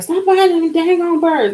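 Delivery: high scolding voice; mother's scolding. Retold or imitated. Mother scolding her over the birds, high exasperated. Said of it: "My mother was like".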